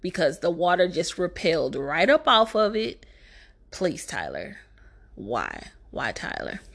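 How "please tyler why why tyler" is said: low, much softer weary plea; drained, begging the director under your breath; exasperated plea. Real, not acted. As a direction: sink into a defeated, breathy why.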